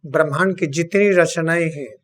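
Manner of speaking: ordinary talk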